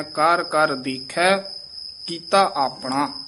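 slow, rising "ਦੇਖੈ", then a pause; halt on ਦੇਖੈ. Shown as a demonstration. Teacher showing the short halt, deliberate rising phrase. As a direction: lift "ਦੇਖੈ" and halt before "ਕੀਤਾ ਆਪਣਾ". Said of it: "ਦੇਖੈ ਤੇ ਠਮਕ ਲਾ ਦੇਣੀ ਹੈ".

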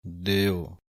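ਦਿਓ said alone with a held pause after it. Shown as a demonstration. Teacher showing the pause at ਦਿਓ. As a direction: say ਦਿਓ then stop. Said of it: "ਇਸ ਤਰ੍ਹਾਂ ਪੜ੍ਹਨਾ ਹੈ".

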